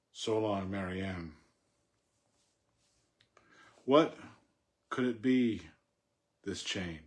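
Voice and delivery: measured, expressive poetry reading with long pauses; poem reading. Acted. Reading his poem aloud to an audience, measured and expressive. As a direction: read it like a poet at a reading.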